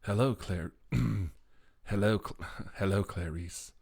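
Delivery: fumbled Lecter attempt, breaking into laughs; Hannibal Lecter impression. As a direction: keep restarting the menacing greeting. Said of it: "I can do the voice of Doctor Hannibal Lecter".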